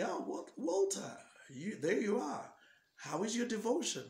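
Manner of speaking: high, overly friendly visitor's voice; devil's friendly greeting. Retold or imitated. Devil disguised as an actor greets Walter, high and friendly.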